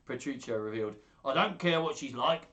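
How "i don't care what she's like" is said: raised, loud wheeler-dealer voice; put-on dealer, speaking up. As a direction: pitch it like a market trader. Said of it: "like a wheeler dealer".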